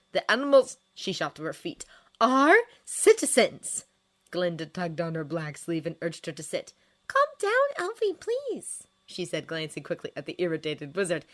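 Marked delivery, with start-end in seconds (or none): 0.1-0.8 loud, high, indignant interruption; outraged objection; heated correction. A narrator's reading. Argument, she shoots to her feet. 2.2-3.9 loud, high, drawn-out emphasis; slow, forceful indignant insistence; heated correction. A narrator's reading. Argument, she shoots to her feet. 7.1-8.9 very high-pitched pleading friend; high, nervous appeal for calm; anxious friend. A narrator's reading.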